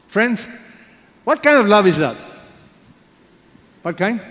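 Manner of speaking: high, loud exclamation of wonder; fervent appeal. Real, not acted. Preacher marvelling at Hosea's love before the congregation, loud and high. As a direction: call out to the whole room in awe.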